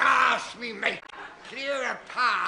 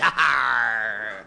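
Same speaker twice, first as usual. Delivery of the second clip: laughing pirate's growled arr; chuckle, then a low piratical arr; low hearty pirate arr after a laugh; 8 semitones lower, 3 dB louder than usual. Acted.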